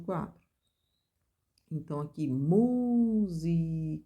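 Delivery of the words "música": "música" slowly, with a loud, stretched "mu"; stress on MU. Shown as a demonstration. Teacher showing the ternary word, stressing MU slowly. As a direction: lean hard on "mu" in "música", drawn out. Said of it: "é o mu".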